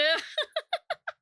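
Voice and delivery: high-pitched burst of laughter. Real, not acted. Vlogger laughing at her own cryptid joke.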